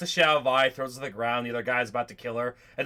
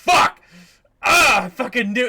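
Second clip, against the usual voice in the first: high, loud furious outburst; yelled, exasperated cursing; Jinx super pissed; 9 semitones higher, 10 dB louder than usual. Acted. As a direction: explode in acted rage. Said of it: "Jinx getting super pissed".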